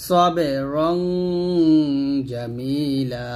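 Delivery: long hummed ng in "রং"; nasal ghunnah. Shown as a demonstration. Teacher reciting for learners, "রং" with held nasal. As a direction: hold "রং" on the nasal, then draw out "জামিলা". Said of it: "অনুচ্ছেদের মতো করে উচ্চারণ করতে হবে".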